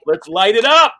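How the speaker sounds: loud, fired-up rally cry; hyped-up cheer. Retold or imitated. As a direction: pump yourself up for the show. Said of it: "I'm like".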